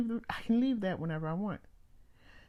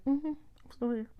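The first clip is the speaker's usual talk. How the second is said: high, light sing-song reply; higher, chirpy and unbothered on the phone; sweet phone answer; 9 semitones higher than usual. Retold or imitated.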